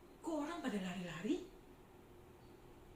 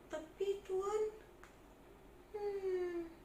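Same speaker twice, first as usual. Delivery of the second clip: high, soft begging voice; poor market trader begging the tax collector; timid pleading trader; 7 semitones higher, 6 dB quieter, much slower than usual. Acted. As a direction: shrink away and plead for mercy.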